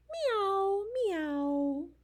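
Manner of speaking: high meow, then a gentler one; cat meow. Acted. Reader answers what the old cat says, cat meows. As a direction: mew like a house cat. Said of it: "What does the cat say?".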